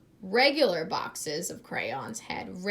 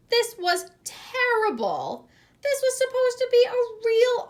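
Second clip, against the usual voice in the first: much higher, louder outraged complaint; indignant child; 8 dB louder than usual. A narrator's reading. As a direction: protest like a wronged kid. Tommy's outrage narrated.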